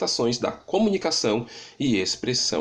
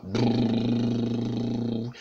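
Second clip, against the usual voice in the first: long buzzing brrr lip trill. Shown as a demonstration. Vocal coach demonstrating a lip trill. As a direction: let the lips buzz steadily. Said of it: "técnicas de canto".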